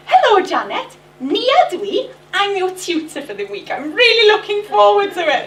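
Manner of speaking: high, loud, chirpy tutor greeting; overeager welcome. Retold or imitated. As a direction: gush like a tutor who is far too keen. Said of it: "I went".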